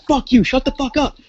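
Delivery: high mock yell of "Fuck you, shut the fuck up"; woman yelling at the neighbour through the wall; angry yell. She yells at the neighbour again.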